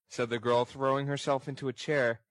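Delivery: usual speaking voice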